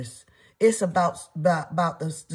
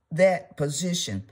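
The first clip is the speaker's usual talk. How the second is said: ordinary talk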